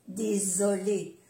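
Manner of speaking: "désolé" slowly, with a drawn voiced z; soft Z. Shown as a demonstration. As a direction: stretch the buzzing z in "désolé". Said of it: "como si fuera una Z suave".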